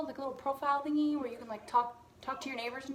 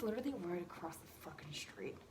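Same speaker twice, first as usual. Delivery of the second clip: unvoiced whisper of disbelief; whispered aside; 5 semitones lower, 9 dB quieter than usual. Real, not acted. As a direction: whisper it like a secret. Shocked aside, cat so near.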